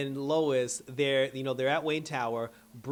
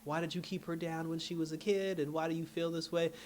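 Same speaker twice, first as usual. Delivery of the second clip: softer, higher probing therapist; mock therapy-session questioning; 4 semitones higher, 5 dB quieter than usual. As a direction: imitate a counselor gently questioning a patient. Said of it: "doing the whole therapy thing".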